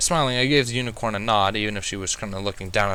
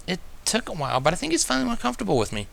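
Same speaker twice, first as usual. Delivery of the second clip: put-on higher, quick, pleased young mare; unicorn voice; 9 semitones higher, faster than usual. A narrator's reading. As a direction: rush out a happy agreement. Twilight voiced, agrees.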